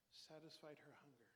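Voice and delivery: ordinary delivery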